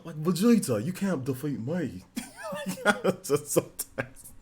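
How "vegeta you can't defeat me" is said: anime villain taunt, slowing down; put-on villain voice, softer at the end; Zarbon. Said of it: "I do, like, Zar".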